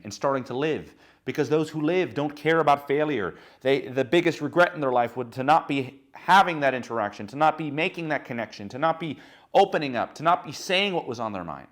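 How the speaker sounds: loud, high, driving motivational push; fired-up speaker's climax; passionate loud preaching. Real, not acted. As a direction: preach it hard, full force.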